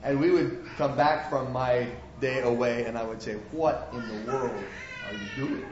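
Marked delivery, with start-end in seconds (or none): 3.5-5.7 scolding boss. Acted.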